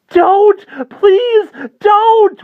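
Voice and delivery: put-on a boy sobbing for his life as he runs; terrified sobbing plea. Fleeing boy begs the swooping vampire, sobbing shriek. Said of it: "Wendell was sobbing as he ran".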